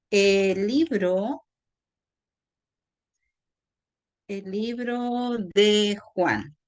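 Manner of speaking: slowly, drawing out each word; slow dictation. Shown as a demonstration. Teacher writing an example for students, slow dictation. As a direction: dictate it slowly with small gaps.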